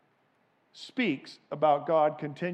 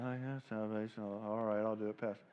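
reluctant congregant; 5 semitones lower, 7 dB quieter than usual. Retold or imitated. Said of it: "you can't".

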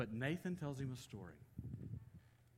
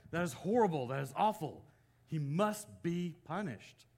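mildly indignant, louder on each verdict; retold outrage; 4 semitones higher, 7 dB louder than usual. Preacher acts out David judging the rich man, retold outrage. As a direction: voice David's fury, punching the words. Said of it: "He's furious. He's incensed".

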